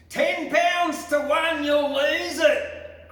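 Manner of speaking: high, loud jeering shout from the crowd; barracker's shout. A narrator's reading. Heckler in the crowd jeers at McDougall, loud high shout. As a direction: bellow a taunting bet across the ground. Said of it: "cried a barracker from the town".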